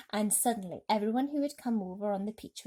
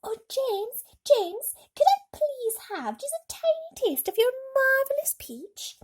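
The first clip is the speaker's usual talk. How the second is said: put-on much higher, loud little girl's voice; little girl shouting; 7 dB louder than usual. A narrator's reading. Little girl in red begging James for peach, high and loud. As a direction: beg for a taste, eager and high. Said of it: "ran out from the crowd and shouted".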